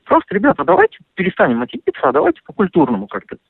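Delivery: usual speaking voice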